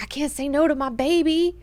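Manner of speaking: high mock whine; hammed-up plaintive complaint; lamenting. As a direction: lament like a helplessly smitten lover. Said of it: "Leto laments that he can't say".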